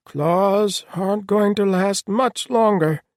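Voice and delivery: very high, loud, drawn-out creature voice; strained. A narrator's reading. Earstripe warning his claws will give out, strained. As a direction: push each word out with effort. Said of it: "sounding strained".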